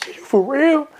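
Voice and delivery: high, tearful retold plea; snotty crying. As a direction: choke it out like you're about to cry. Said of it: "the floodgates here. I'm, I'm pouring, I'm crying".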